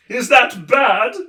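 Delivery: high, loud, slow fretful cry; anxious question; worried humbug. A narrator's reading. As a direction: humbug frets about the valley. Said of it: "perpetually worried humbug".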